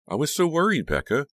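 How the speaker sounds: high, emphatic worry; worried friend. Acted. Trish tells Becca how worried she was, high and concerned. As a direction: gush with worry, pitch swooping up.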